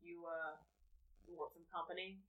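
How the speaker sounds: low, soft come-on; half-orc suitor. Acted. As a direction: sidle up with a low, hesitant offer. Half-orc hits on player's character.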